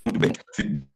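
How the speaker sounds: choppy robotic internet voice; glitchy cutting-out call audio; robotic broken audio. Real, not acted. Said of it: "Sua, sua voz está robotizada e tá cortando muito".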